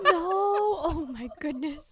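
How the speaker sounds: host cracking up at the story; laughing. Real, not acted. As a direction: draw it out through laughter. Reacting to the story.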